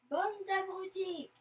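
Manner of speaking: loud, high, drawn-out insult; angry old man's outburst; vociferating. A narrator's reading. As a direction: roar it indignantly, stretching the words. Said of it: "vocifère-t-il".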